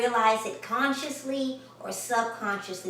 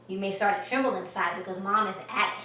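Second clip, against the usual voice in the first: usual speaking voice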